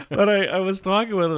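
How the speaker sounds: laughing, words come out high. Real, not acted. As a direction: laugh as you speak. Still laughing at the story.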